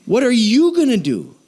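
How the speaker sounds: "What are you gonna do" high, with a punch; insistent challenge. Real, not acted. Pastor turning it back on the listener, "What are you gonna do" high and pressing.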